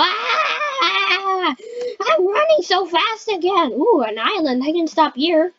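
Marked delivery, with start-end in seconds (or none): 0.0-3.7 high, slow drawn-out cry; panicked wail. Pretend. Player speeding off in the game, panicked wail. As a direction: wail it while being swept along.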